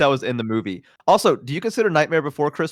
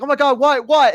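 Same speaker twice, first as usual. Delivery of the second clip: startled mom; 13 semitones higher, 6 dB louder than usual. Retold or imitated. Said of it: "And she was like".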